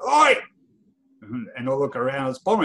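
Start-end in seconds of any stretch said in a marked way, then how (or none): 0.1-0.3 loud call across the car park; yelled oi. Retold or imitated. Captain calling a nervous fan over with a shout. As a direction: shout to get attention from afar. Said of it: "someone yells out".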